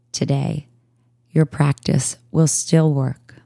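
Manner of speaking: calm, even voice with long pauses between phrases; unhurried yoga nidra guidance; guided meditation. Real, not acted. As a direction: guide listeners into stillness, leaving space after each phrase.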